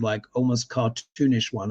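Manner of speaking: ordinary talk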